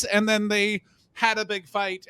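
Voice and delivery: normal talking voice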